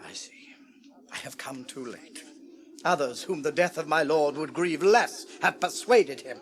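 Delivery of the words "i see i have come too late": unvoiced, barely there; defeated whisper. Counselor conceding to himself, whispered. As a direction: breathe it out in defeat.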